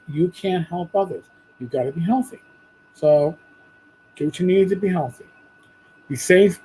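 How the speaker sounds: ordinary talk, nothing marked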